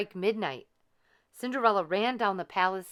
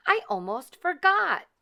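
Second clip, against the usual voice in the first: flustered fairy godmother; 10 semitones higher, 7 dB louder than usual. A narrator's reading. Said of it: "cried the fairy godmother".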